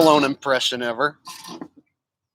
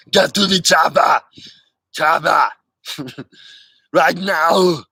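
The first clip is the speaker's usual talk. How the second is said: louder, urgent "Get to the chopper" in Arnold's accent; Arnold impression; 4 dB louder than usual. Shown as a demonstration. Corrected take for the viewer, louder Austrian accent shout. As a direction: order them onto the chopper. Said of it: "Or, excuse me".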